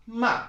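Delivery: "ma" very high, falling sharply; emphatic falling Mandarin "ma"; fourth tone. Shown as a demonstration. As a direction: throw "ma" down from a loud, high start. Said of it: "these are the four".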